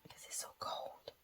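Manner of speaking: whispered, no voice. Real, not acted. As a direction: breathe it like a secret about the cold. Mother whispers to shivering child.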